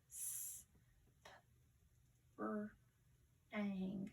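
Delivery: "S, p, r, ang" as separate sounds with pauses; sounding out. Shown as a demonstration. Teacher helping pupils build a word, sounding out "S, p, r, ang". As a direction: say each sound of "S, p, r, ang" alone, consonants short and soft. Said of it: "let's sound this out".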